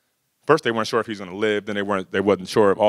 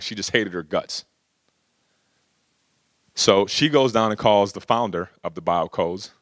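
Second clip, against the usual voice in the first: ordinary talk, nothing marked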